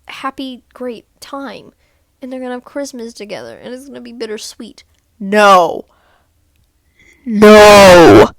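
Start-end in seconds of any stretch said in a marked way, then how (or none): 5.2-8.3 loud outraged no; shouted no. Real, not acted. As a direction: yell it in disbelief. Outraged at show ending.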